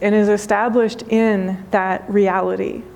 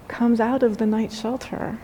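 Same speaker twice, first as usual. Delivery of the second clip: ordinary talk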